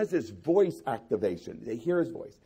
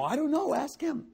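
high, shrugging parents brushing it off; dodging parents; 11 semitones higher than usual. Acted. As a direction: toss the question away in a raised voice.

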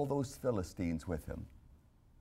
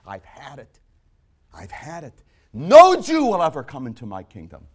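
a mock fed-up Jesus going from mutter to shout; imagined Jesus fed up; 3 semitones higher, 16 dB louder than usual. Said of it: "he said".

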